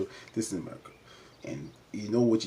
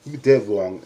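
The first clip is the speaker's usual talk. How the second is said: loud retort; 10 dB louder, slower than usual. Retold or imitated. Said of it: "She was like".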